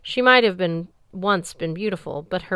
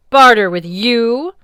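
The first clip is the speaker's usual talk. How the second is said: slow, loud, scornful scoff; 5 semitones higher, 13 dB louder, slower than usual. Acted. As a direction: scoff at the very idea. Goddess scoffs.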